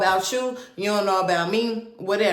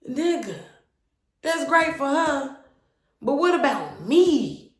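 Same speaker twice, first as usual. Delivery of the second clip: higher, bitter retort; hurt anger; 7 semitones higher than usual. Retold or imitated. Woman answering her boyfriend, hurt and bitter. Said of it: "And I'm like".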